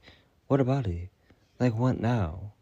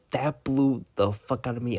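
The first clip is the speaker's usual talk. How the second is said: ordinary talk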